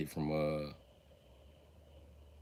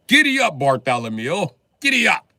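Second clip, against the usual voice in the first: high, loud rider's call; shouting giddy up; 4 semitones higher, 6 dB louder than usual. Acted. Drunk midget spurring his Great Dane, yelling. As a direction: urge the dog on, yelling.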